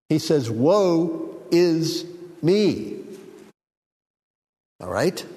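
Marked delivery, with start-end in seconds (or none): 0.5-3.0 very slow, stretched woe is me; drawn-out lament. Shown as a demonstration. Preacher voicing Isaiah's despair for the congregation, slow. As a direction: stretch it out like a groan. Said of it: "So what does Isaiah say?".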